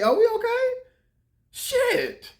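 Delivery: can you believe this; incredulous. Real, not acted. Incredulous at the critics.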